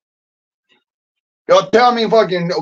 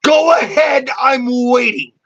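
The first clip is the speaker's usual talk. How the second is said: challenging yell, much higher; angry shout; 4 semitones higher than usual. Real, not acted. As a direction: dare them to answer, shouting and close to tears. Taunting the person he's ranting at.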